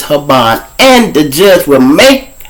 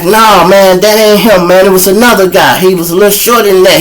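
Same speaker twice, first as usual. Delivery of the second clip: high, raised-voice retold protest; insistent denial; 8 semitones higher, 4 dB louder than usual. Shot man clears the suspect to police, insistent mimicry. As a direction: mimic a witness insisting they have the wrong man.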